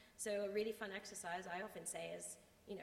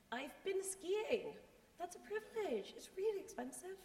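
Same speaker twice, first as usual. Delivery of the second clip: joking voice; 11 semitones higher, 3 dB louder than usual. Acted. Joking aside about skiing privilege.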